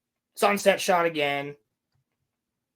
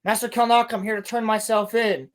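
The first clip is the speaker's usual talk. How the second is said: mock firmer call; 6 semitones higher than usual. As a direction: announce yourself boldly. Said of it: "She's like".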